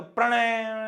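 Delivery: long, ringing final vowel; reverberation. Shown as a demonstration. Teacher imitating a shout in a big hall, lingering tail. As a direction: stretch the end of "Pranay" as it lingers. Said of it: "ऐसा सुनाई देता है".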